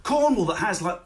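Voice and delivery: normal talking voice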